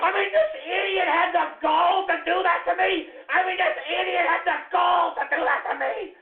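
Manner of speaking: high-pitched, indignant shouting; outraged ranting. Acted. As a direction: yell in disbelief at the insult. Outraged rant about an offender.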